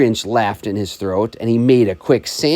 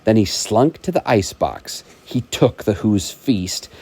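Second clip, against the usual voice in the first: ordinary delivery, nothing marked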